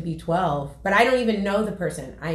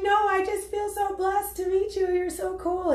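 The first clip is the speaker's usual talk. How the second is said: high, teary voice of overwhelmed joy; choked-up, blessed and tearful, pitched way up; tearful gush; 12 semitones higher than usual. Retold or imitated. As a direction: gush through half-held tears, much higher. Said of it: "So I start weeping in front of him".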